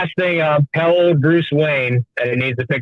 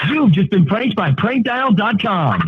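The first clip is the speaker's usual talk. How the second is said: slow, high announcer read; 7 semitones higher, slower than usual. Acted. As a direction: announce it like a recorded message. Recorded prank announcement.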